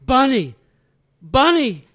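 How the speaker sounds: put-on loud, high cry of the child's name; calling out. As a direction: call out to a lost child just found. Mother calls her found daughter.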